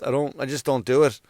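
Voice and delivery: ordinary delivery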